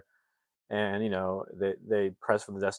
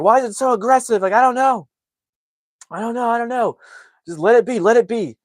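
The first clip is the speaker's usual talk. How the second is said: high, loud flustered outcries; panicked exchange; 13 semitones higher, 10 dB louder than usual. Retold or imitated. Family arguing over the aggressive GPS, panicked. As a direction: act out a frantic back-and-forth.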